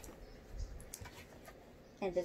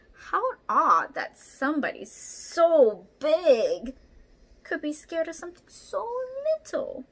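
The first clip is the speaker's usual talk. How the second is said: wondering aloud; 7 semitones higher, 8 dB louder than usual. Acted.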